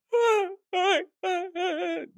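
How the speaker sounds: high, wailing mock sob, retelling a breakdown; imitated weeping. Telling the host how she wept after the call, a high mock wail. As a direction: act out bawling in a bathroom stall. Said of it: "that kind of crying".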